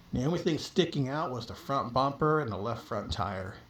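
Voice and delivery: plain reading voice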